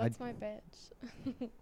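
whisper. Real, not acted. Gushing over Austin Powers.

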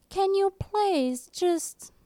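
high, drawn-out British teacher; mock British accent. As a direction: imitate a teacher's English accent, slowly. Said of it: "teachers in high school with this fucking English".

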